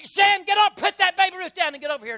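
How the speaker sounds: loud, high frazzled dad shouting; harried father hollering across the checkout; yelling at the kids. Retold or imitated. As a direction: bark orders at unruly boys in a store.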